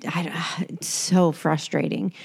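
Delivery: whispered through frustration; a real frustrated whisper. Real, not acted. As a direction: breathe it out, exasperated.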